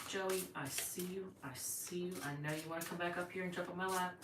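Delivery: quiet, affectionate murmur; hushed, indulgent pet talk; soft aside to pet. Real, not acted. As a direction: speak softly aside to the pet.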